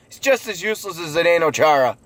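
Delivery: ordinary delivery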